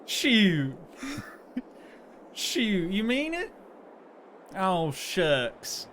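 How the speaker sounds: playful high Southern American country voice, laughing into it; aw-shucks Southern country charm; country accent. Acted. Streamer answering chat's compliment in a bashful Southern country accent. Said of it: "Your country accent's very convincing".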